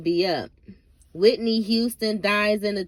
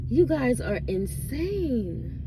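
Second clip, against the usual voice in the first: slow, higher, drawn-out disbelief; exasperated disbelief; 6 semitones higher, slower than usual. Real, not acted. Vlogger tells gossip-obsessed fans they're crazy, slow and drawn out. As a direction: stretch it out, stunned at them.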